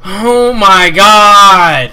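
slow, very loud, high groan of dismay; drawn-out outcry. Real, not acted. Gamer reacting to getting killed, long loud groan. Dies in game.

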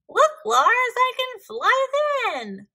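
high, loud joyful shout; happy cry. A narrator's reading. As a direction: call out overjoyed, stretching the words. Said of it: "he cried happily".

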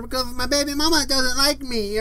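high mock self-pity; mocking complainers. Imitating negative posters on social media, high mock whine. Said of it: "They all say".

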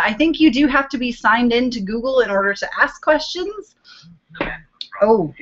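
ordinary talk, nothing marked

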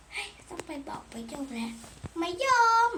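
ordinary delivery

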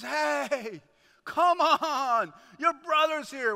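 loud, high coaxing call. Acted. Father calling older son in to the party, loud and urging. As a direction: stretch it out, urging him to come.

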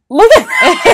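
high laughing cry; can't hold the laugh in; laughing shout. Real, not acted. Bursting out laughing.

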